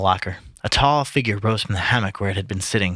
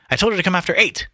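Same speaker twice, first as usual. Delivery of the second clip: high, petulant grumble; sudden pet; 10 semitones higher, faster than usual. A narrator's reading. Mulligan cross at the late milkwoman, in a pet. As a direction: grumble it in a huff. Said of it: "sat down in a sudden pet".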